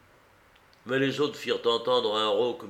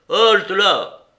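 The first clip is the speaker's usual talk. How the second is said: much louder, higher commanding cry; shouted halt; 9 semitones higher, 12 dB louder than usual. A narrator's reading. Silver stopping Morgan's knife, commanding shout. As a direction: stop him dead with one yell. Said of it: "cria Silver".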